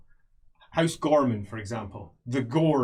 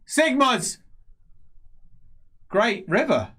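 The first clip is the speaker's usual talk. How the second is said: raised, halting proclamation; confused prophesying priest; 8 semitones higher, 3 dB louder, slower than usual. Acted. Priest hit by words he does not choose, halting loud cry. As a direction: call out each fragment loudly, with long pauses. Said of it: "as if the words are hitting him".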